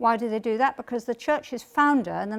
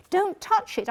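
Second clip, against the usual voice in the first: urgent; 10 semitones higher, slower than usual. Retold or imitated. Urgent plea at computer screen.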